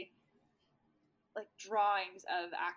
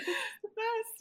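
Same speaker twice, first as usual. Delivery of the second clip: high, gushing mock delight; giddy delight; 3 dB louder than usual. Jackie enjoying being a vampire, high and giddy. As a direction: squeal how great this is. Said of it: "She's like".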